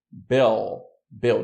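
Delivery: "bell" slow, with an unrounded dark L. Shown as a demonstration. Teacher showing the dark L in "bell". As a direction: stretch "bell" and pull the L back. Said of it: "Note que eu não faço o biquinho".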